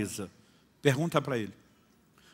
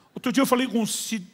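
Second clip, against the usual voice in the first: much higher, much louder fiery preaching; preaching at full force; 11 semitones higher, 9 dB louder than usual. Acted. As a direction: pastor tells the congregation a joke, loud fiery preaching.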